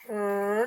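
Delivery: "Er" held as a long r sound. Shown as a demonstration. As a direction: hum the r of the blend. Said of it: "to make the digraph blend".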